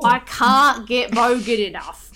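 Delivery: slow, loud stab at Aussie bogan; half-successful broad bogan Australian; attempted bogan accent. Shown as a demonstration. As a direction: try and fail at a bogan accent. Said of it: "It just, it doesn't sound right".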